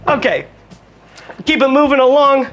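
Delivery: bada bing like a cartoon wiseguy; mock mobster. Host hams up a mobster cheer over the mozzarella.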